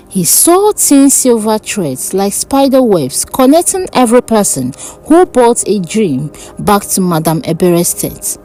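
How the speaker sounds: ordinary delivery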